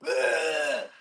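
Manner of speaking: mock gym scream. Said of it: "meathead who's screaming".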